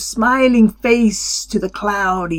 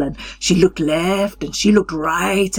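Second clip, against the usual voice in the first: plain reading voice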